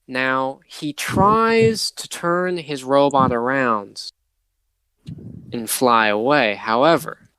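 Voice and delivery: normal talking voice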